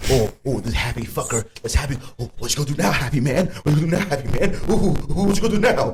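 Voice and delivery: high, loud taunting sing-song; mocking coworkers. Rapper voicing coworkers taunting him at work, high loud mockery. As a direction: taunt the happy man like a jeering coworker. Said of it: "they're like".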